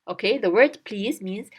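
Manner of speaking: normal talking voice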